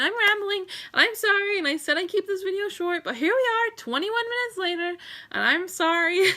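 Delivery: real tearful rambling apology; teary apology. Real, not acted. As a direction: apologize while crying and half laughing. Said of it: "I'm sorry that I cry in front of you".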